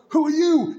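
high, loud, incredulous demand; mock astonishment. Hearing friend's thick Indian accent, mock astonishment. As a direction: act thrown by a stranger in your friend's place.

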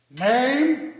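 loud, high desk sergeant call; sergeant barking a question. Acted. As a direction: call it over the station noise. Sergeant asks in noisy station.

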